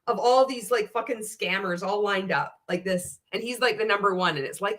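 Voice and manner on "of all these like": normal talking voice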